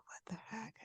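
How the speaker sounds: breathy unvoiced "What the heck"; whisper. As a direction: whisper it in disbelief. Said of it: "I'm like".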